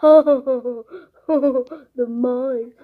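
drawn-out villainous laugh around the words; laughing in mock triumph; gloating laughter. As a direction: gloat with a staged evil laugh. Villain laughing over taken snack.

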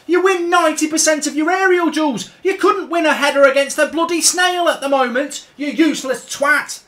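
high, loud shouted insults; angry tirade. Real, not acted. Gamer shouting abuse at his virtual defender, angry. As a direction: tear into the defender. Berating Harry Maguire.

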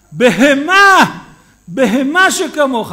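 loud, slow, high furious yell. Retold or imitated. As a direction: roar the insult at the driver. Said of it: "הוא נותן לו צעקה". (צעקת זעם רמה ואיטית)

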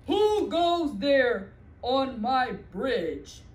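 loud, slow troll boom. Acted. Troll guarding his bridge, booming slowly. Troll challenges middle goat.

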